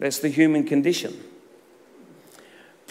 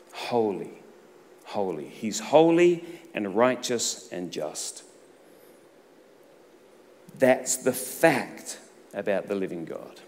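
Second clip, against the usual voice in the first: solemn preaching; 3 semitones lower, slower than usual. Acted. Preacher to congregation on God's holiness, hushed reverent intoning. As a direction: lay each holy down gently.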